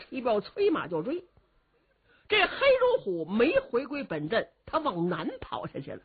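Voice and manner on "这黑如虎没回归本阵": ordinary delivery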